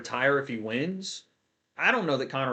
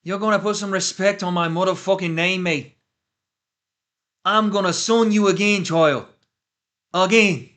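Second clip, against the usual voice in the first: loud, high mock Irish accent shouting the tweet; 6 semitones higher, 9 dB louder than usual. For chat, loud mock Conor Irish accent. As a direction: belt it out like Conor in a thick Irish brogue. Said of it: "you want it in the accent?".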